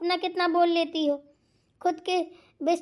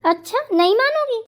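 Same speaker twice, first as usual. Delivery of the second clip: louder, raised-pitch challenge; indignant, insisting loudly; annoyed warning; 4 semitones higher, 6 dB louder than usual. Acted. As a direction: push back hard in a squabble. Quarrel, warning before calling mother.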